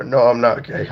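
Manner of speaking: ordinary delivery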